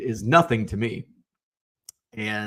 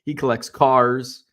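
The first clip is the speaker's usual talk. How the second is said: slow, careful emphasis on "cars"; "cars" stretched out deliberately; stress on cars; slower than usual. Real, not acted. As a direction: linger on "cars" to make the word clear. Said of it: "without the D".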